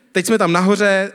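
normal talking voice

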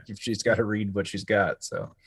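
ordinary delivery

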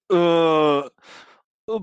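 high groggy hungover groaning. Retold or imitated. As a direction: drag the name out groggily. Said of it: "she's so hungover".

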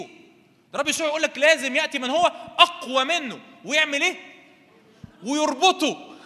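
high, loud, driving sermon delivery; forceful preaching. Real, not acted. Preacher declares the stronger one must bind him, full force. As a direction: build to a shouted climax on the final words.

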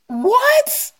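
high, incredulous what; reliving her disbelief; retold shock. Retelling her stunned reaction.

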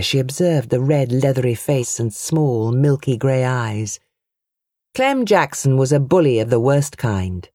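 ordinary delivery, nothing marked

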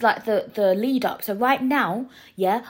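ordinary delivery

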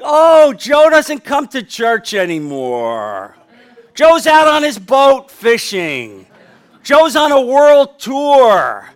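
slow, loud, high drawn-out announcements; hammed-up booming report of someone's exploits; mock proclamation. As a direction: stretch each phrase in exaggerated dismay. Imagines God watching Joe's new life.

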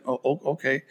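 soft sheepish okay with a laugh; meek quiet okay. Retold or imitated. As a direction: mumble it like a caught speeder. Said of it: "I said".

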